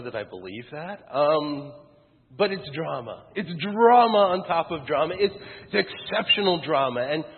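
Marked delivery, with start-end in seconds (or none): none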